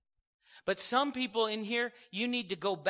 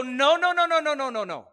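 loud, rising string of no's; commanding no; 4 semitones higher, 8 dB louder than usual. Retold or imitated. Believer rebuking the storm from the boat, loud. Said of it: "stand on the stern of the boat and go".

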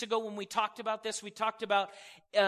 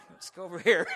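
laughing mock retreat; 2 semitones lower, 4 dB louder, faster than usual. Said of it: "you know, you".

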